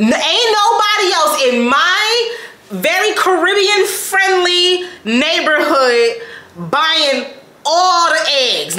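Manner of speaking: high, slow mock outrage; hammed-up indignant complaint; exasperated. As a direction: drag it out, indignant. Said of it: "And I'm like, "Bro".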